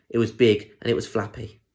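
usual speaking voice

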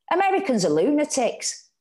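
asserting. A narrator's reading. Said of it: "Anna asserted".

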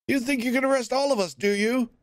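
taunting heckler. Acted. Prisoner taunting the warden, fast. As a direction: dare him to try.

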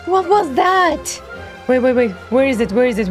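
ordinary talk, nothing marked

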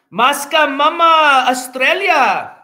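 loud, high, drawn-out mock Australian call; botched Australian accent. Streamer hailing an Australian donor, botched Australian accent. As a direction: holler it slowly in a failed Australian accent. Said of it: "that wasn't an Australian accent".

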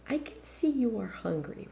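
soft whisper. A narrator's reading. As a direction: breathe the words gently. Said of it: "Razel said".

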